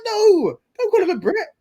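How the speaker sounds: high-pitched mock objection; comically high, exasperated protest. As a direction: plead in a high, indignant pitch. Acts out own protest, high.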